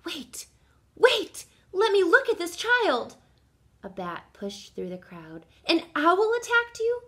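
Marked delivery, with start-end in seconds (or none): 0.0-3.2 high, loud, urgent call; urgent excitement. A narrator's reading. Bat bursts through the crowd. 5.6-7.0 high, loud, rising disbelief; shocked question. A narrator's reading. Shocked bat questions lost child.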